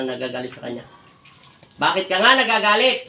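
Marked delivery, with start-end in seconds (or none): 1.8-3.0 raised, loud questioning with rising end; insistent demand. Real, not acted. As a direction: demand the answer forcefully. Healer insists after no answer.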